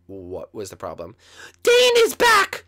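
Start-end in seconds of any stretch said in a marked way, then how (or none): 1.6-2.6 very high, loud, drawn-out squeal of news; alarmed sidekick. Acted. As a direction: announce it slowly in a piercing tiny voice.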